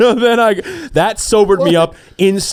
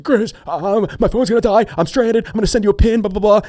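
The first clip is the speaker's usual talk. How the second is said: frantic voicemail; 8 semitones higher than usual. Retold or imitated.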